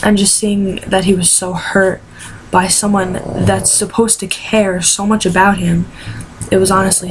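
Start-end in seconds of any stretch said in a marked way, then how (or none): none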